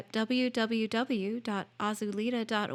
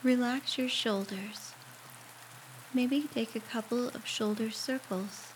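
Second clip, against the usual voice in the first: guided meditation; 8 dB quieter than usual. Acted. Guiding a listener through a shower meditation, calm and unhurried. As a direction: ease the listener gently through each step, leaving space to breathe.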